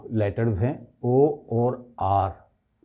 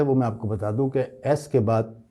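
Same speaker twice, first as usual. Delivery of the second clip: ordinary talk, nothing marked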